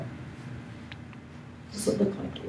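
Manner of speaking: slow, breathy whisper; whispered question. Acted. Asking the congregation, a slow hushed whisper. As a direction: lean in and whisper it slowly.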